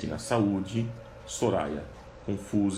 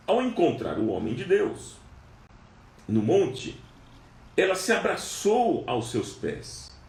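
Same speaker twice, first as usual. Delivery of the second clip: fervent preaching; 5 semitones higher than usual. Acted. Preacher retelling the Shunammite's journey to the congregation, fervent. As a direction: drive the story home with fervour. Preaching the story at full force.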